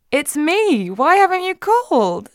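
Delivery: high, loud eager burst; excited. A narrator's reading. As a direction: blurt it out thrilled and high. Calling Jeff at dawn, eager.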